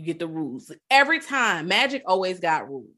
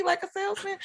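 salesman; 13 semitones higher, 5 dB quieter than usual. Retold or imitated. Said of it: "he's almost a little pushy".

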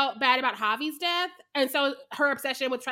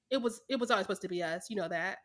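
softer, lower, quick quote; teen version's line; 5 semitones lower, 7 dB quieter, faster than usual. Retold or imitated. Teen self consoling her dying older self, softly voiced. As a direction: say it low and hushed, in mild imitation. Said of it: "her teen version's like".

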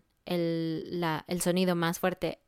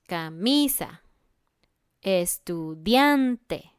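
"camisa, estudiante" slow, MI and DIAN drawn out; penultimate stress; much slower than usual. Shown as a demonstration. Teacher giving more examples, next-to-last stress in "camisa, estudiante". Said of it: "el sonido fuerte es el sonido penúltimo".

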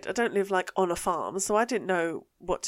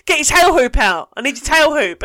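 louder, higher insistent bark; barked order; 6 semitones higher, 9 dB louder than usual. Retold or imitated. Steward repeating his demand at the confused driver, loud bark. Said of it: "this bloke just barks at me".

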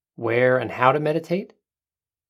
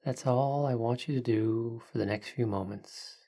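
soft spa whisper; 5 dB quieter than usual. Acted. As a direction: hush it down, calm and unhurried. Leads a guided breathing meditation.

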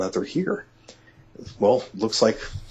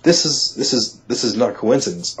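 insistent excitement; 3 semitones higher, 4 dB louder than usual. Retold or imitated. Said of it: "I said".

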